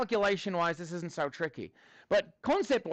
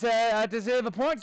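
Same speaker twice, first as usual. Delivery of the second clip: normal talking voice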